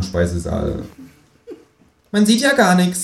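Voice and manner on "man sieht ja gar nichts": high, squeaky kangaroo voice; high cartoonish kangaroo complaining; Känguru voice. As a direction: pitch it way up as the kangaroo. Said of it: "sagt das Känguru".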